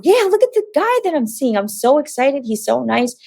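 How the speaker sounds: high, bubbly bragging that eases down; excited showing off. Retold or imitated. Showing her roommate the magazine cover, high excited bragging. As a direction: start up high and giddy, then settle.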